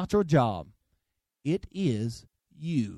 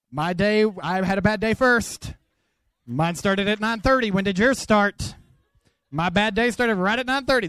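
higher, louder, playful one-upping; hammed-up spousal bickering, higher and louder; mock competitive rant; 5 semitones higher, 6 dB louder than usual. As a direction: insist you won the bad-day contest. Arguing with spouse over bad day.

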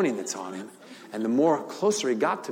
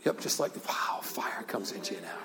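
hushed prayer whisper; 6 semitones lower, 9 dB quieter than usual. Real, not acted. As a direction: whisper it softly over her. Minister praying over healed heart.

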